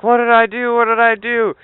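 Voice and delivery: a hint of a groggy innocent kid; whiny kid. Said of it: "He's like".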